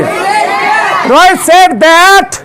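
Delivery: high, drawn-out classroom shout; loud call-out. Shown as a demonstration. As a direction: shout it slowly for the class to echo. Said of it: "जोर से बोलिए".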